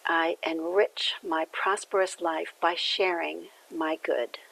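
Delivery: slow affirmation, falling to a soft close; repeated affirmation. Shown as a demonstration. Minister repeating the affirmation for listeners to take in, slow. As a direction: say it slowly as your own affirmation, trailing off on good. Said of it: "the affirmation that comes along".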